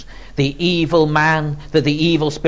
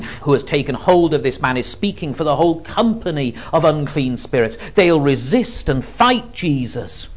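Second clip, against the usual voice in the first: ordinary talk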